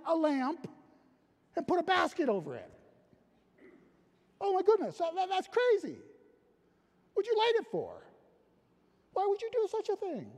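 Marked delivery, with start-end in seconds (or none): 4.4-10.3 mock disbelief. Mocking hiding a lit lamp.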